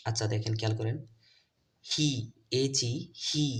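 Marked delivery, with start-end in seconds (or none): 1.8-3.5 "হি" with a clear ee, spelled between. Shown as a demonstration. Teacher spells for learners, "হি" said distinctly with ee. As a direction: sound out "হি" slowly as hee. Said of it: "সেটা হচ্ছে ই".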